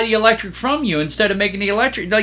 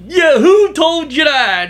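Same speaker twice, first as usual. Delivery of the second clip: mock surprise; 8 semitones higher, 5 dB louder, slower than usual. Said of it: "He goes".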